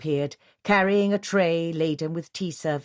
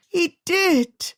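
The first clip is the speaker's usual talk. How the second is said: insistent; 13 semitones higher, 5 dB louder than usual. A narrator's reading. Insistent as if doubted.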